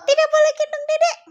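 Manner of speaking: high, loud squeaky cat voice; kitten character. Pretend. Cat forbids Cici to carry baby, high bossy cat voice. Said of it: "Dedeknya kucing gitu maksudnya".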